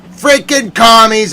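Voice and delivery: high, loud curse; pissed. Real, not acted. Cursing the commies mid-rant, pissed off. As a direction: snap into an angry yell. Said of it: "I'm pissed".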